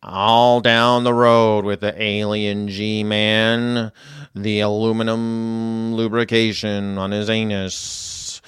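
put-on very slow, low, drawn-out old writer's voice; William S. Burroughs impression. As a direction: drag each word out like a weary old beat writer. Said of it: "He talks like this".

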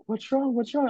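high, gentle imitation of a small teacher; concerned professor. Professor checking on crying student, high gentle voice. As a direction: pitch up to play the worried lady. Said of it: "And she's like".

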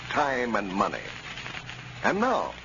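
usual speaking voice

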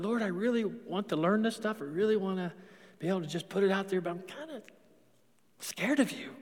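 hushed, hesitant prayer to the Lord; quiet confession. Retold or imitated. Preacher retelling his own prayer, softly and hesitantly. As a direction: murmur it like an unsure confession. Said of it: "I said that one time to him".

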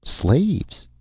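high, bright one-word answer; surprised lilt. Acted. Robot's casual shocking answer.

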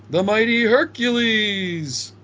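booming ring announcer intro. Retold or imitated. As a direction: announce him to the arena. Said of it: "He's reading cue cards".

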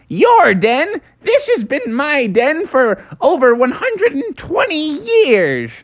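high-pitched cartoon groundhog; indignant little critter. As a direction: squawk back as the offended tiny animal. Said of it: "the little critter was like".

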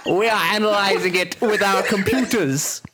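reading through giggles; can't keep a straight face; laughing while reading. Real, not acted.

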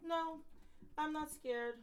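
high, mocking Jersey tough-lady voice; a hammed-up Kim D brushing off fear; imitating Kim D. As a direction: play her like a fearless gangster grande dame, way up high. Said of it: "Kim D's like".

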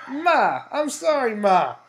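loud, high, hammed-up cry to Ma; mock pleading apology. Son hamming up an apology to his mother, loud and high. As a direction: wail it at Ma like a guilty son.

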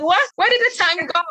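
high, astonished what, where did the time go; playfully stunned at how fast time went; mock surprise. Host recalling Esme's graduation, mock surprised. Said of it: "I'm like".

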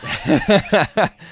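high, loud burst of laughter. Real, not acted. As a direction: laugh out hard. Crowd laughter after joke.

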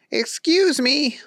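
high, slow, goofy; politely squeaky; high dopey voice; Booger voice. A narrator's reading. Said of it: "Booger said".

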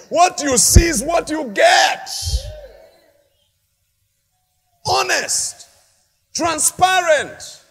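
loud, slow, emphatic preaching; rising preaching shout. Real, not acted. Pastor exhorting members to be open, shouting. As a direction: build to a shouted climax.

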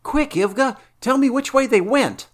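high, loud urgent command; urgent knight. Acted. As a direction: press her for the way. Valerius urgent.

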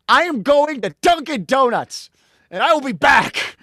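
high, loud angry announcement; furious exit. Retold or imitated. Fed-up mother announcing she's leaving, high loud furious yell. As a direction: storm out, shouting high and loud. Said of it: "She's like".

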